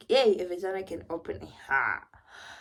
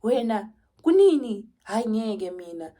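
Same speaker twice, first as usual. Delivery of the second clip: louder, higher outraged nurse; indignant nurse; 4 semitones higher, 9 dB louder than usual. Retold or imitated. Nurse scolding the mother in Zulu, loud and indignant. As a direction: burst out in disbelief, raising the voice. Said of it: "She's like".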